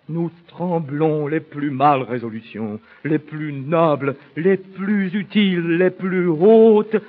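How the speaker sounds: stage crescendo, stretching the final phrase; swelling declamation. Acted. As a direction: let each item top the last. Soliloquy swells to a climax.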